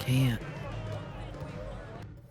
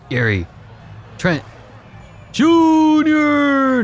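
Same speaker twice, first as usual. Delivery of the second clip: high, loud drawn-out name; play-by-play holler, stretched and high; excited shot call; 5 dB louder, much slower than usual. Real, not acted. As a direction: belt the name out as the shot flies. Calling the player's shot.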